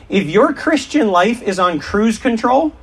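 normal talking voice

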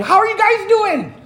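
put-on thrilled dad greeting his frightened kids; excited dad. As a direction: beam through the window at the kids. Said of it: "he was so excited with the storm".

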